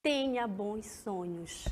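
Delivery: soothing bedtime wish; tender wish. Shown as a demonstration. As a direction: murmur a sweet-dreams wish. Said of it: "Deseja algo a alguém".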